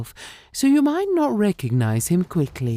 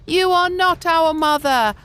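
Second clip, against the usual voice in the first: put-on high, loud children's refusal; goat kids accusing; 4 dB louder than usual. A narrator's reading. The kids catch the wolf by his paws, high and loud.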